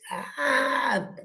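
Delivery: normal talking voice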